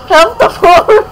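high, loud play-begging; insistent beggar. Pretend. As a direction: push the plea up high and loud. Repeated begging, escalating.

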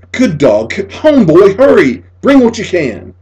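high, loud, pleading call to the dog; urgent pleading. A narrator's reading. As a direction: beg the lost dog to hurry home. Boy pleading for his dog.

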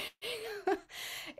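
high, delighted imitated laugh; Dalai Lama's laugh. Teacher imitates the laughing monk for her class, high happy laugh. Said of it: "started laughing".

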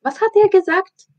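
put-on high, loud child's voice; Pippi asking. Pippi asking her friends about the director, high loud child's voice. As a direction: pipe up high like a bold little girl. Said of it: "fragte Pipi".

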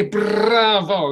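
bravo with a trilled r; rolled r bravo. Shown as a demonstration. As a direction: roll the r in bravo with the tongue. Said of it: "roll your ra's with your tongue".

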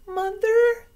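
tiny squeak, very high and thin; squeaky baby bat. Acted. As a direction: call out like a lost baby bat. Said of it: "Stella Luna squeaked".

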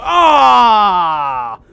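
sudden loud cry of alarm; startled yell. Real, not acted. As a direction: yelp as the trap goes off. Caught by a sabotage trap.

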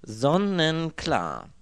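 sehr gedehnt, laut und höher; selbstgefällig. A narrator's reading. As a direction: Sonnenklar genüsslich dehnen. Inspektor selbstgefällig. (selbstgefälliger Inspektor)

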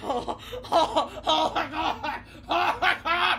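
laughing through it after the fright; nervous laugh. Real, not acted. Player laughing after surviving the scare. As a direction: laugh off the terror.

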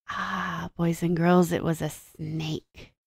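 slow, soft aside of mock awe; hushed reveal. Teacher revealing the snake to boys and girls, hushed. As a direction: let the secret out softly and slowly.

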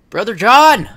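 loud stagey call across the stage; theatrical call. Acted. Brother calling to Brother John in the play, loud stagey call. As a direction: call out to your brother, hammy.

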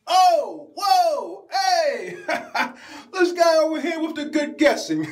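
a delighted character laughing at a good guess; hammed-up character. Skit character reacting to guess.

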